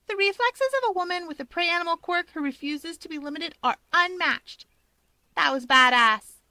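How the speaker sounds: put-on high bright girl voice; young woman voice. A narrator's reading. Rika's voice, high.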